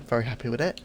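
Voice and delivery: usual speaking voice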